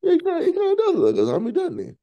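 high and fast through a laugh. Real, not acted. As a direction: let the laugh push the pitch way up. Laughing at zombie comparison.

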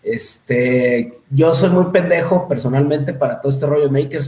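usual speaking voice

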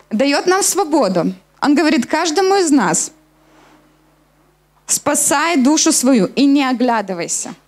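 normal talking voice

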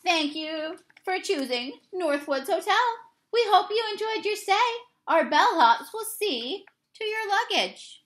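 high, brisk customer-service voice; cheerful desk clerk to the bear; chirpy hotel clerk. Acted. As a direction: rattle off the welcome. Hotel clerk greets Bruce.

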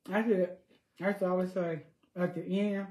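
ordinary talk, nothing marked

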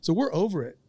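ranting at the fanatics; mock fired-up player. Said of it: "He goes".

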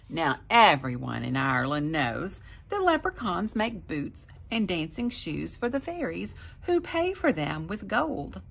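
plain reading voice

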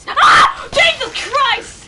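very high, loud shriek of fright; terrified scream. Real, not acted. Player hit by a jump scare, terrified scream. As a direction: scream in shock, then stretch the oath out.

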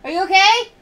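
host checking on a coworker; calling out. Real, not acted. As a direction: call across the room. Calling to someone off camera.

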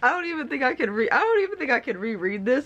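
fast, high, on the verge of tears; real tearful overwhelm. Real, not acted. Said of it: "it's making me cry again".

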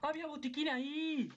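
high, drawn-out frustrated cry; exclaiming. Real, not acted. Gamer to himself on spotting a missed medkit, exclaiming. Shouted exclamation, then same words plainly.

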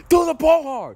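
shouting at a friend to finally throw hard; full shout. Acted. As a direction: scream the order at him. Exasperated final demand.

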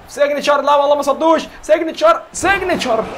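high, loud hype calls at the screen; excited yelling. Real, not acted. Gamer hyping his signature move loud. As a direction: scream the move name in excitement.

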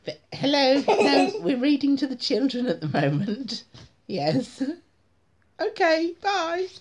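phone voice. Real, not acted. Phone call while reading.

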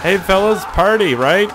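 high, loud shout to the fellas; excited party call. Pretend. Calling out to partygoers.

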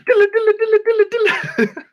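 high, rapid nonsense trill; playful high "dilla-dilla" burble; silly gibbering. Pretend. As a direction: babble a silly high-pitched noise. Silly nonsense noise for children.

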